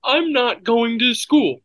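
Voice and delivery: high, slow whiny voice; whiny sick voice. Retold or imitated. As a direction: whine like a sick kid. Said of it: "mocking the daughter's excuse".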